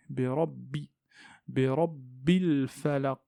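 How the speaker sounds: "rabbi" with a held double b; held shadda. Shown as a demonstration. Teacher stresses the shadda in "bi rabbil falaq". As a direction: lean on the "bb" of "rabbi". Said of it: "on insiste un petit peu".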